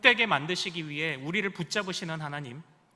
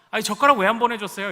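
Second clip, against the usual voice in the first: quick, louder complaining call; blunt complaint; 4 semitones higher, 8 dB louder, faster than usual. Retold or imitated. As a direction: snap at the delivery shop a bit. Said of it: "이렇게 얘기하죠".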